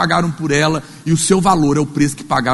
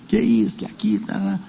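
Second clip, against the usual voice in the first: mock gossip whisper; 5 semitones higher, 5 dB quieter than usual. Imitating gossip in restaurant.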